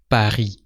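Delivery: "Paris" the French way, final S left silent. Shown as a demonstration. Teacher showing learners the unpronounced last letter in "Paris", silent S. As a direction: say "Paris" in French, ending on the ee sound. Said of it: "you don't pronounce the S".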